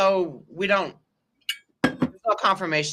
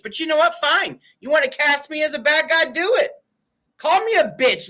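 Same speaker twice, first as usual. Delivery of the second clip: high, pitched-up defiance; defiant; 9 semitones higher than usual. Real, not acted. Host defying critics who cast her as the bad guy, high and defiant. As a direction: throw the challenge back at the critics.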